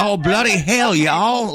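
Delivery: high-pitched British slipping into Southern hillbilly; British-Southern hillbilly accent. Shown as a demonstration. Husband mocks his own accent, British gone Southern hillbilly. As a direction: ham up botched British with a hillbilly twang. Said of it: "a little bit of Southern hillbilly or something".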